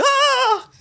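high-pitched mock scream; imitated crowd scream. People on the boat seeing her fall, imitated scream. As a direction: scream like horrified onlookers, hammed up. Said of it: "all of the people on the boat going".

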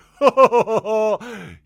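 loud, high mock laugh; clerk's put-on laugh. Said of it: "he talks like this".

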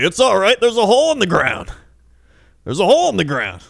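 put-on high, loud creaky old-man voice; creepy Bilbo voice. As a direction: play a wheezy old Bilbo warning about a hole. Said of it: "him being, like, creepy Bilbo".

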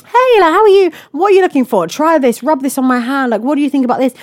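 high, bright shop assistant greeting; customer service voice. Pretend. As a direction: act like an overly friendly sales assistant. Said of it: "if she'd come to me and said".

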